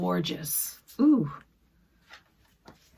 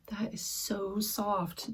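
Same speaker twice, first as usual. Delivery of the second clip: whisper; 7 dB quieter, slower than usual. Real, not acted. Feeling soft lace.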